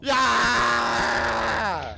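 loud victory yell; triumphant shout. Real, not acted. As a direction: cheer it out big. Triumphant after breach.

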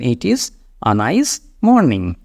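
drawn-out, word-by-word English; slow model sentence. Shown as a demonstration. Teacher tells learners what to say on waking, slowly. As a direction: pace it for a beginner to copy. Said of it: "সকালে উঠে এটা বলবেন".

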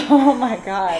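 laughing. Real, not acted. Laughing reaction to a joke.

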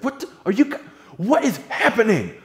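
high, loud confused outburst, slowing down; bewildered panic. Retold or imitated. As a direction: sputter in alarmed confusion. Ryan confused, hearing friends laugh.

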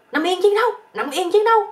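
high, loud mock battle order; yelling. Old army teacher to his bedmate, a hammed-up combat yell. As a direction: yell it like a military command, hammed-up. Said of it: "ổng la lên".

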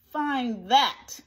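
scornful bird's challenge; sneering. A narrator's reading. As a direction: throw it down as a sneering dare. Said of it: "sneered the bird".

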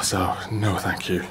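ordinary talk

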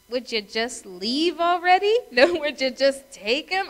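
loud, high-pitched exasperation; exasperated inner thought. Acted. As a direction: plead impatiently, acting out your private thoughts. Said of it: "I'm thinking to myself".

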